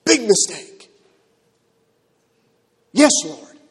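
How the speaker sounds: loud yelp falling away into compliance; startled disciples. Acted. Disciples jumping back from an angry Lord, startled and backing down. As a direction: jolt back, then submit quickly. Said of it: "can you imagine everybody jumping back".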